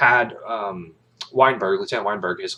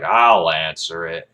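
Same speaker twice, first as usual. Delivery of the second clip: low, slow, firm reply; defiant calm; 4 semitones lower, 5 dB louder, slower than usual. Retold or imitated. Jessup coolly accepts the question, low and deliberate. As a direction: settle in and take the challenge. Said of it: "He's like".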